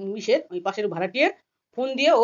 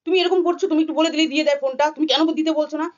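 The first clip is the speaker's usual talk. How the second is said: higher, louder retold scolding; hot-tempered scolding; 6 semitones higher, 4 dB louder than usual. She scolds me over the phone, in an angry, higher and louder voice. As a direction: snap back at her with heat. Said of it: "তখন আমার গরম দেখাচ্ছে".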